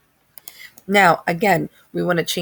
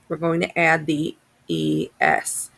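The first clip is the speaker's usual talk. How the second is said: ordinary talk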